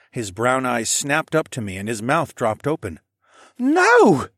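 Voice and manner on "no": high, loud gasping cry; gasped no. Acted. Shocked boy gasps a denial to Alex, high and loud. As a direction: gasp it out in alarm. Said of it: "he gasped".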